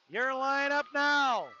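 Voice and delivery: slow, drawn-out PA announcer call; arena announcer call. Real, not acted. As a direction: stretch each word like an arena announcement. Announcing the new line on ice.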